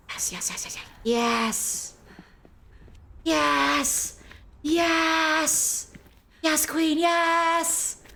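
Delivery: rising triumphant cheer. Real, not acted. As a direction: build from a whisper to a cheer. Cheering as enemy leaves.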